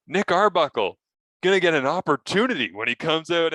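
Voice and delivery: broadcast tease voice. Retold or imitated. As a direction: pitch it like a TV promo. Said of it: "I essentially said".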